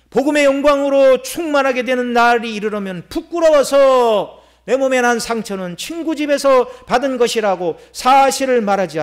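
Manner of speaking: high, forceful pulpit delivery; impassioned preaching. Real, not acted. Preacher exposes the ashamed false prophets, impassioned preaching. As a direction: preach it urgently at full force.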